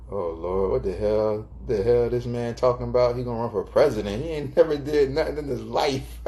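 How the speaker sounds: lightly voiced doubting partner, scoffing at the idea; a touch of disbelieving sass; skeptical girlfriend. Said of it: "And you're like".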